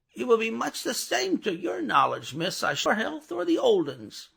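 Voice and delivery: a gruff man done in a high put-on voice; Jerry's voice. A narrator's reading. Jerry answers Miss Pross.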